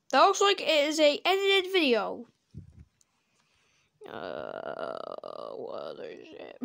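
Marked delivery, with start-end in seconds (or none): 4.1-6.6 very low goofy voice; deep voice. Acted. Sudden deep silly voice.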